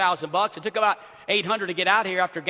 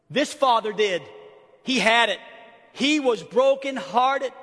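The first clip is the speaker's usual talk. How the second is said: ordinary talk, nothing marked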